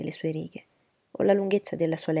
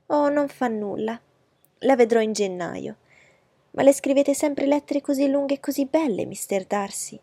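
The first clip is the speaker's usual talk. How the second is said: higher, louder, gushing lady's voice; fawning society lady; 7 semitones higher, 6 dB louder than usual. Acted. Miss Bingley fawning over Darcy's letter, higher and gushing. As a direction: flatter him from a higher, eager pitch.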